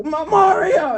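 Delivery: high, stuttered heckling call; mock holler. Lady still heckling after ten minutes, mock holler. Imitates lady repeatedly calling across train.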